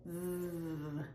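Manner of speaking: buzzing V; voiced V. Shown as a demonstration. Teacher isolating the voiced V. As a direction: hum through V. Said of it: "that's voiced".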